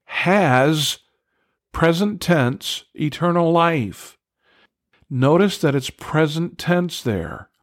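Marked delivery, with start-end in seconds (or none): 0.0-4.2 "has" lifted and leaned on, set apart; slow, pointed "has" before eternal life; stress on has. Shown as a demonstration. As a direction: hit "has" to show the present tense. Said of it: "watch this".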